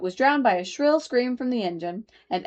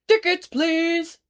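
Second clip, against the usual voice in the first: slow, raised conductor call; gruff guard; 9 semitones higher, 4 dB louder, much slower than usual. A narrator's reading. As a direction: call out for tickets like a train guard. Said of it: "said the guard".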